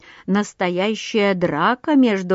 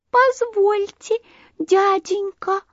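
tiny high-pitched voice, drawn out; high, thin, stretched-out little plea; thin little bird voice; 12 semitones higher, slower than usual. A narrator's reading. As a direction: pipe up slowly as a small timid sandpiper. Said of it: "послышался в толпе птиц тоненький голосок".

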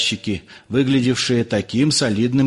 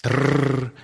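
rolled trilled rattle; cracking crate noise. A narrator's reading. Crate being pried open, imitated as a rolled trill. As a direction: trill it like wood splintering.

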